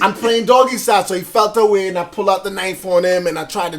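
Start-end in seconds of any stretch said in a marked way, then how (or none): 0.0-1.0 put-on loud, higher Caribbean-accented retort; Caribbean accent on request. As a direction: argue back in Caribbean accent, amused. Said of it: "say it in that accent".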